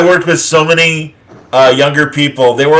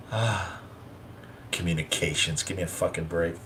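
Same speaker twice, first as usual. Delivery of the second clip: muttered; 8 semitones lower, 18 dB quieter than usual. Real, not acted. Muttered complaint aside.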